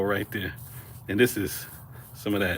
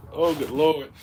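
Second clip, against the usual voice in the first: ordinary talk, nothing marked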